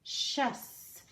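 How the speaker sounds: "Shus" breathed out with no voice; whispered ending syllable. Shown as a demonstration. Teacher isolating the end of precious.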